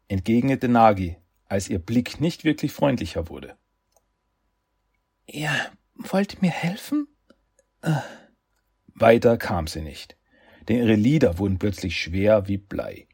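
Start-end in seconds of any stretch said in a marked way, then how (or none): 5.3-8.0 high, rough croaking voice; raspy croak. Acted. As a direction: croak it out in disbelief. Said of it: "ein raues Krächzen".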